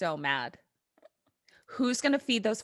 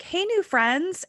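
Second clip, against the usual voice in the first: cheery cop greeting; 9 semitones higher, slower than usual. Retold or imitated. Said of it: "be like".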